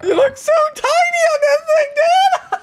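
high shout through laughter; laughing shout. Real, not acted. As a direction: yell while laughing. Kid laughing at dad on wolf.

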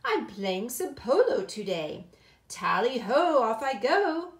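loud, jaunty gentleman's farewell; ringing tally-ho as he rides off; dapper cousin. Acted. Cousin leaving for a polo game, jaunty and loud.

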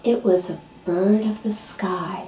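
soft, slow whispered narration; hushed storytelling. A narrator's reading. Narrator whispers the sunrise for the audience, slow and soft. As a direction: draw listeners into the hush.

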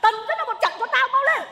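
high, urgent goading; furious command. Acted. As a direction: order the beating impatiently. Mother orders son to beat wife.

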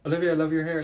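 usual speaking voice

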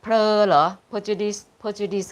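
"Portuguese" standing for prejudice, said "pre-judice" with a "pler" start; trying corrected pronunciation. Shown as a demonstration. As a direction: test "เพลอ" as the first syllable, questioningly. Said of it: "ออกเสียงว่า".

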